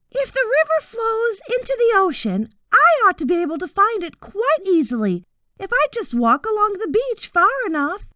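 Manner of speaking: high, light voice for the father thinking aloud; father's thoughts. A narrator's reading. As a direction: lift the pitch as he reasons to himself.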